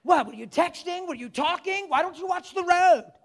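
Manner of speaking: loud, high mock interrogation; ticked-off scolding. Preacher plays annoyed driver accusing her, ticked off. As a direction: berate them with rising, hammed-up indignation.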